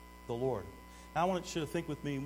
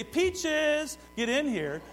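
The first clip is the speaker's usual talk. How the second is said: high, loud call to a dog; 11 semitones higher, 6 dB louder, much slower than usual. Retold or imitated. Owner calling the dog Peaches, loud and high.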